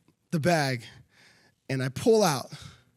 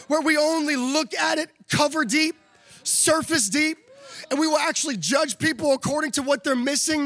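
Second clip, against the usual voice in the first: raised, driving preacher's close building to a shout; preaching at full force; 6 semitones higher than usual. Acted. Pastor urging the church to honor people, building to a shout. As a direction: push the appeal home to the church.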